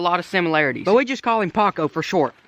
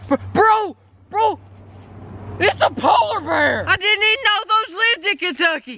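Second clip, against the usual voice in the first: mock shock; 9 semitones higher than usual. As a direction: yelp like you spotted a bear. Fake alarm at a polar bear.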